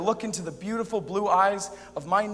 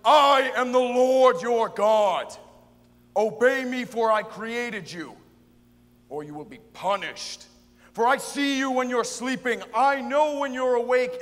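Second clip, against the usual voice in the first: powerful, authoritative God; 4 semitones higher, 7 dB louder than usual. Acted. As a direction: thunder it out like a commanding Almighty. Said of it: "so God's voice must be powerful, authoritative".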